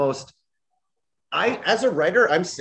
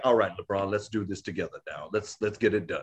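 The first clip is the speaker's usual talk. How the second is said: lower, softer laid-back voice; cool Michael Jordan; 6 semitones lower, 5 dB quieter than usual. Retold or imitated. Jordan joining LeBron, a low laid-back cool voice. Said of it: "and is like".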